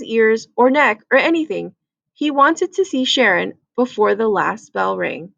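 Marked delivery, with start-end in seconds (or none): none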